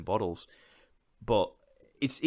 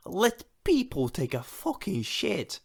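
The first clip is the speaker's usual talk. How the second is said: higher and slower, fed-up plea; exasperated outburst; 10 semitones higher, slower than usual. Retold or imitated. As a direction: drag it out in disbelief. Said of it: "I'm like".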